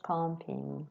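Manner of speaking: "camping" with the first A almost like an O; French nasal A. Shown as a demonstration. Teacher explaining French vowels, "camping" with A almost like O. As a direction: round the "am" of "camping" into a French "on"-like sound. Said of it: "almost like an O in French".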